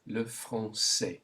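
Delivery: "Le français" slowly, with s sound in the last syllable; French pronunciation. Shown as a demonstration. Teacher shows learners the cedilla combination, French pronunciation. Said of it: "Just pronounce it to get the combination".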